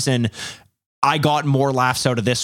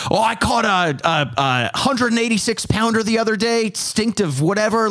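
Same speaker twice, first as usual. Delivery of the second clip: lightly voiced boasting fisherman, higher pitch; imagined fisherman; 7 semitones higher than usual. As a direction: play a fisherman bragging, pitched up.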